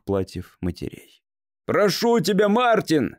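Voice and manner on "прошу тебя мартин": high, raised, urgent call; pleading shout. A narrator's reading. Man to a boy lost in a crowd, crying out pleadingly. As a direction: cry out an appeal across a distance. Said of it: "снова закричал Слоун".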